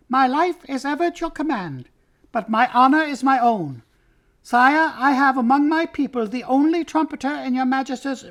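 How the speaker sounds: shrill, high-pitched formal mouse; Reepicheep. A narrator's reading. Mouse petitioning his king to be a marshal, shrill voice. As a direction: pipe it up high with courtly ceremony. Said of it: "came a shrill voice".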